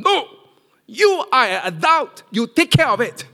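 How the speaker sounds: loud, high, stern telling-off; firm refusal. Retold or imitated. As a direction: lay down the law to a grown son. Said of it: "That's what I told him".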